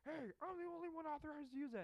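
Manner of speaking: loud, high, fast mock objection; hammed-up high-pitched protest; mocked marshal. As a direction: imitate an officious marshal objecting.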